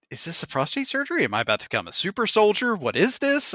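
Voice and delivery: high-pitched mock alarm; retold bewilderment, pitched well up; incredulous questioning. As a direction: question it in rising disbelief. Acting his alarm at being strapped down.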